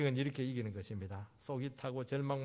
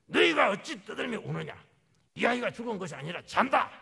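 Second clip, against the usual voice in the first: high, loud scolding in the Lord's role; acted rebuke; 8 semitones higher, 9 dB louder than usual. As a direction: rebuke the noisy mourners forcefully. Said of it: "주님께서 막 책망을 합니다".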